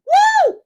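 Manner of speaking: very high, loud woo; excited whoop. Real, not acted. Host celebrating her own song, a high loud whoop. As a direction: shriek with delight.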